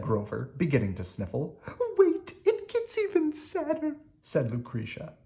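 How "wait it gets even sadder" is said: very high, lamenting princess voice; a princess pouring out her sad tale. Acted.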